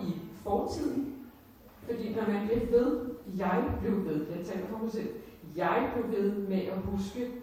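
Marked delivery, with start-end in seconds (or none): none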